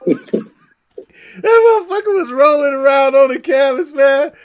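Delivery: high and loud, words breaking into laughter; laughing while talking. Real, not acted. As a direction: talk through helpless laughter. Laughing retelling fighter rolling around.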